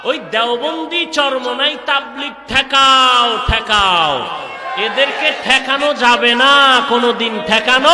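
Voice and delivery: loud, rousing waz cry, endings drawn out; rally the crowd with stretched, forceful phrases; full-force preaching. Real, not acted. Preacher defending his movement against opponents, full-force preaching.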